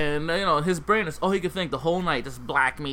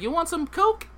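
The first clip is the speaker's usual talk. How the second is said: much higher pushy street hustler; hooker voice; 13 semitones higher than usual. Retold or imitated. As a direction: pitch it up like a dealer offering.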